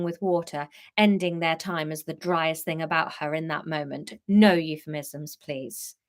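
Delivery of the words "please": ordinary delivery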